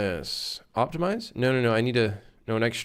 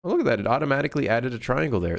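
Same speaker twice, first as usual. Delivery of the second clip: ordinary delivery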